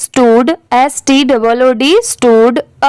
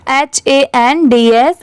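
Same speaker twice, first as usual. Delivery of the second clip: ordinary talk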